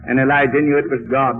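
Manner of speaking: usual speaking voice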